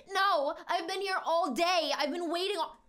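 high, drawn-out mock whine of protest; protesting crowd. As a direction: mimic indignant people being shoved past. Crowd protesting at being pushed past.

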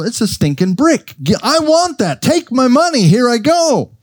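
caught-up consumer craving the new phone; eager buyer. Retold or imitated. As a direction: gush like a shopper who must have it now. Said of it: "you go".

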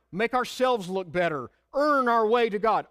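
ordinary delivery

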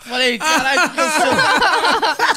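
loud high laughing yell; laughing outrage. Retold or imitated. Dwarf shouts through laughter at being feared, loud high.

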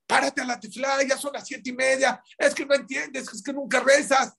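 high, exasperated nagging father; scolding parent. Retold or imitated. Father nagging his son in bed to get up for prayer, exasperated and high. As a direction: nag the sleepy kid to get up and pray.

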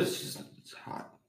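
pained, whispered gasp; barely voiced through burning; breathless whisper. Real, not acted. Mouth burning from hot sauce.

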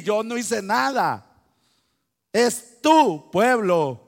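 loud, high defiant retort, slow and emphatic on tu pueblo. Retold or imitated. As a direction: hammer home that it is your people. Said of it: "cómo se le planta a Dios".

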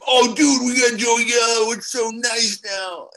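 high, loud hyped-up fan imitation; over-the-top mock bragging, high and loud; excited fans. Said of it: "And they're like".

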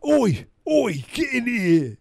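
very high-pitched mock British yelp; British bishop. British bishop calling someone in, exaggerated British accent. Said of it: "Bishops are British. They're like".

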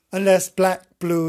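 ordinary talk

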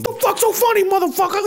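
high, put-on angry yell; hammed-up street voice; mock angry bum. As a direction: snap at a laughing passenger. Imitating angry homeless man.

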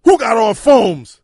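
loud, slow, drawn-out demand; imitated bouncer. Storyteller mimicking club security greeting them, loud slow demand. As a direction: boom it out like a doorman challenging the line.

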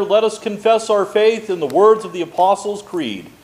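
usual speaking voice

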